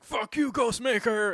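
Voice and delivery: high, loud mock yell; hammed-up shout at a rival. Batman yelling at rival.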